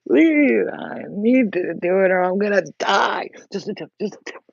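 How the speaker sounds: high, loud whiny plea then slow; mock a desperate man pleading; begging man. Imitating men begging her, high and whiny. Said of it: "You know how they".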